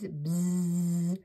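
long steady buzzing; bee buzz. Shown as a demonstration. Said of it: "That's a lot of bees".

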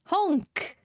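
high goose honk; silly clown. Pretend.

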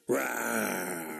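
low growling croc roar; crocodile roar. Acted. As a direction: give a big crocodile rawr. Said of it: "Can you give us a big crocodile noise".

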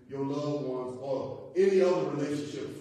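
usual speaking voice

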